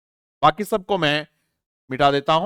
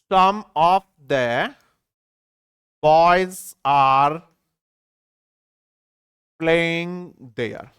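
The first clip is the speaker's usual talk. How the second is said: very slow, broken into pieces with long pauses; slow chunked reading; much slower than usual. Shown as a demonstration. Teacher breaking up a sentence for students, slow chunks. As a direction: read it bit by bit for the class. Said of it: "ये लिखा हुआ है".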